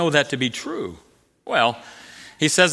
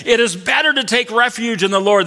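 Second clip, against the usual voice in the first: higher, proclaimed congregation reply; congregation's response; 5 semitones higher, 3 dB louder than usual. Shown as a demonstration. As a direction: answer as the gathered congregation would. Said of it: "They say to him".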